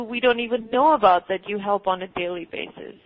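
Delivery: ordinary talk, nothing marked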